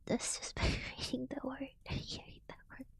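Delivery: usual speaking voice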